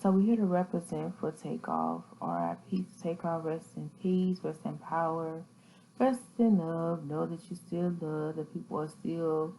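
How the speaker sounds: hushed, low memorial tone; quiet, subdued condolence; solemn tribute. Real, not acted. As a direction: speak softly and low, paying respects.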